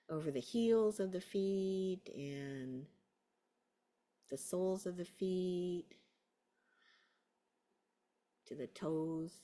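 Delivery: slow, drawn-out guiding phrases; guided meditation. Real, not acted. Teacher guiding awareness over heels and soles, slow guided meditation. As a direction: lead their attention along, leaving long gaps.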